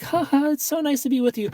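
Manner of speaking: hammed-up fake giggle; fake laugh. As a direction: laugh like everything's perfect.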